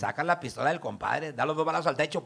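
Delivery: normal talking voice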